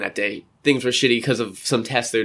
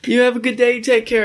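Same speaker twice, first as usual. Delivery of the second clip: retold street yell; 10 semitones higher than usual. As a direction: call out to a friend across the road. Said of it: "someone yelling at someone".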